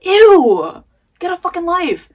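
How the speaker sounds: mock yourself like a bully would; inner critic mocking. Voicing her harsh inner critic at herself, sneering and loud. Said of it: "it's like".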